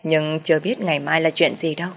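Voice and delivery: plain reading voice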